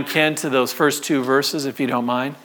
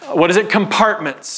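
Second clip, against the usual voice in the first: ordinary talk, nothing marked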